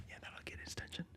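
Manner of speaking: unvoiced whispered aside; whispering. Real, not acted. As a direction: whisper it to yourself. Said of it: "whispered aside".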